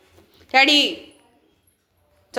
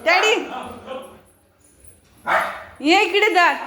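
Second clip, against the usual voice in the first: raised, insistent calling voice; calling the dog; 5 semitones higher than usual. Real, not acted. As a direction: summon the dog over with a treat.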